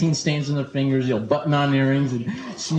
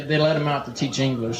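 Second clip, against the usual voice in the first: ordinary delivery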